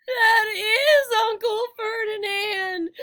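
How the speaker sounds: high, loud, slow cry of horror; horrified cry. A narrator's reading. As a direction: cry out in shock at the statue. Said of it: "Grover gasped".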